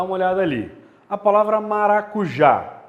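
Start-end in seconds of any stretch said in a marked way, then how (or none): 1.6-2.8 "maracujá" loud, with "já" stressed; "maracujá" called out as maracu-JÁ; stress on last syllable. Shown as a demonstration. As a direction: hit the final "já" of "maracujá". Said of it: "É o já".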